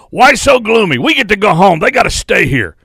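lightly voiced rough coach after a road loss; gruff coach. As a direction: bark it out like a cranky coach cheering up the team. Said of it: "he would talk like this all the time".

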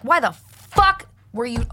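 high, angry mother's outburst; re-enacted yelling. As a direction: yell at the daughter, getting worked up retelling it. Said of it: "You're yelling a lot".

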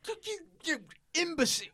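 fast, high mock-exasperated cry; exasperated insult. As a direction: spit it out fast, high and scornful. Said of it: "He's like".

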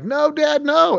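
put-on high whiny kid voice; squeaky mock-whine of a kid; whiny kid protest. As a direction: mimic a reluctant teenager whining. Kids whining about garage work.

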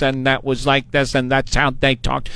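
put-on robotic old computer voice; old speech synthesizer. Shown as a demonstration. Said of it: "remember the old computer voices?".

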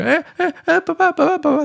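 dad imitating his crying son calling for daddy; imitating a crying toddler. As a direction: mimic a little boy wailing for daddy. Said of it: "就是他会开始哭".

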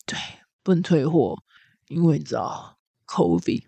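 low, soft, slow jaded clerk; world-weary clerk. Retold or imitated. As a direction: sigh it out like you're bored to death. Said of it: "非常的厌世".

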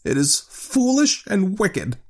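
slow, high-pitched hesitant protest; faltering. A narrator's reading. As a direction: object haltingly, losing your nerve. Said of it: "he faltered".

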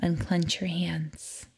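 slow whispered instruction; whispering. Acted. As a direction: whisper it slowly and softly. Relaxation instruction.

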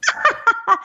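high squealing laugh. Real, not acted. Laughing at shared joke.

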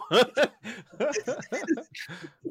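squealing high laugh; high giddy laugh. Real, not acted. Laughs at odd noise.

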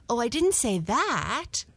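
lightly voiced cat, hastening to reassure; quick protest. A narrator's reading. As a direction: protest mildly and promptly. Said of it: "went on Blackie quickly".

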